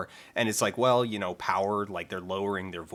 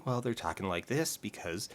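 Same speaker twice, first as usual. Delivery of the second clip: normal talking voice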